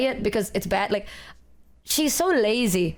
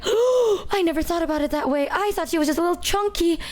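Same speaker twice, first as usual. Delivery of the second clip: much higher, quicker faux-naive gasp; mock shocked innocence; 8 semitones higher than usual. Answering the vet about her obese dog, mock innocent. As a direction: play dumb in a high, rushed put-on voice.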